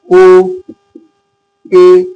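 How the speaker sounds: ও and এ as isolated vowels; vowel sounds demonstrated. Shown as a demonstration. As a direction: say ও, then এ, with a long pause between. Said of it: "যখন উচ্চারণটা এই রকম হবে".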